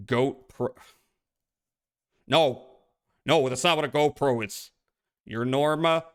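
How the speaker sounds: ordinary talk, nothing marked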